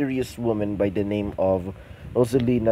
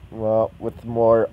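ordinary delivery, nothing marked

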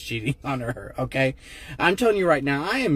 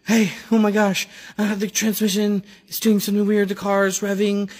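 panicked; 8 semitones higher, 4 dB louder than usual. Retold or imitated. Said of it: "and she said".

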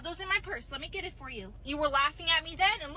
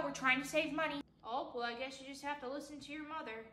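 ordinary delivery

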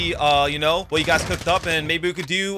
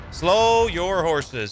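drawn-out taunt; much slower than usual. Acted.

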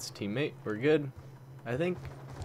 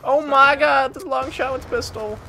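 loud high excited shout; thrilled; 12 semitones higher, 8 dB louder than usual. Real, not acted. Gamer amazed at his long pistol kill, loud high cheer. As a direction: cheer the lucky shot.

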